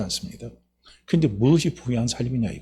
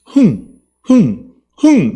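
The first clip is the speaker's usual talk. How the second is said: short loud bursts of "heung"; loud, isolated "heung" shouts; emphatic "heung" cheer; 6 semitones higher, 7 dB louder, much slower than usual. Shown as a demonstration. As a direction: punch each "heung" out separately. Demonstrates nose-blowing chant "heung.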